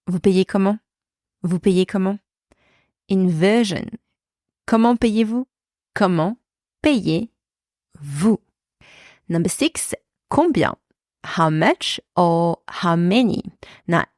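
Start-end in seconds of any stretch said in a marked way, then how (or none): 5.9-8.4 word by word, long gaps; slow breakdown. Shown as a demonstration. French teacher showing inversion, slowly segmented. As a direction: separate every piece for learners.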